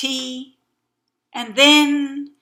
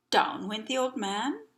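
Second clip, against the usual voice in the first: ordinary delivery, nothing marked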